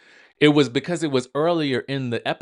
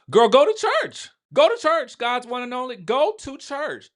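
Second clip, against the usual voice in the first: much higher and louder, scolding; exasperated yell; 12 semitones higher, 4 dB louder than usual. Real, not acted. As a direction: call out to her, slowing the last one down. Yelling advice at a castmate.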